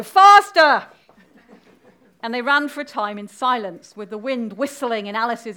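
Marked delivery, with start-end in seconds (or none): 0.0-0.9 very loud, high shout; urgent command. Acted. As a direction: cry it out to drive the runner on. Queen urging Alice to run.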